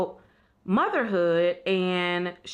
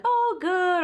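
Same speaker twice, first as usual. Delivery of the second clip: breezy fake cheer; 12 semitones higher, 3 dB louder than usual. Mock vague cheerful answer.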